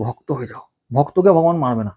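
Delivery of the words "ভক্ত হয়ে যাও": "ভক্ত হয়ে যাও" said much softer, dropped to a hush; soft urging. Shown as a demonstration. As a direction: lean in and say "ভক্ত হয়ে যাও" quietly, like a secret. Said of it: "ওই জন্য বলছি". (নরম নিচু গলায় "ভক্ত হয়ে যাও")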